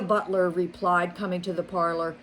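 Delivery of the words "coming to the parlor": ordinary delivery, nothing marked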